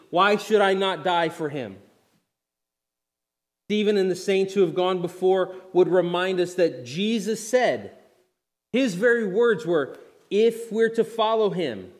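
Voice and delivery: high, loud pulpit delivery; impassioned preaching. Real, not acted. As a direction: preach it out with rising conviction.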